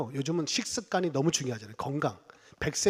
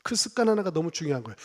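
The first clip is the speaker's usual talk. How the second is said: ordinary talk, nothing marked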